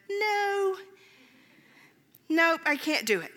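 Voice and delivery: high, whiny refusal from Moses; reluctant Moses. Acted.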